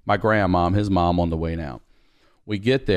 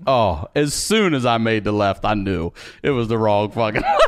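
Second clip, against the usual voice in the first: laughing; 2 semitones higher than usual. Real, not acted. Laughing at own mistake.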